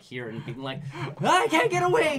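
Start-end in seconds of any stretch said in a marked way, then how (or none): 1.2-2.2 high, loud panicked shriek; mock terror. Player stuck inside a VR horror game, high panicked shriek. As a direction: shriek it as if trapped.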